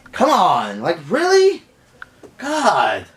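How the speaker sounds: high exasperated disbelief; incredulous. Acted. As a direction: let the pitch leap in disbelief. Exasperated reaction to the retold cheating.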